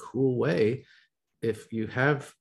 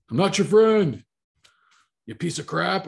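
mock hostile retort; 8 semitones higher, 7 dB louder than usual. Imagined rude reply to "friend.

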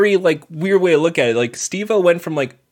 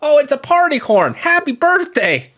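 high put-on party greeting; high voice, stretching out the birthday wish; birthday skit character; 10 semitones higher than usual.

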